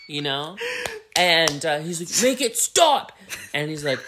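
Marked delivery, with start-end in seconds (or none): 2.2-3.1 high, loud hammed-up plea; frantic mock demand. Upset man begs bar friends, high frantic demand. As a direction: cry it out at a high pitch.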